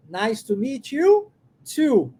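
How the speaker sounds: too at the end given weight, said slowly; slow, clear demo with too at the end; slow demo line. Shown as a demonstration. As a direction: lean on the final too. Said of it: "Esse to quer dizer também".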